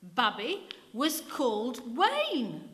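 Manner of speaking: put-on a slow stubborn boy's claim; undeterred. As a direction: spell it out slowly and insist. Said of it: "said the boy, undeterred".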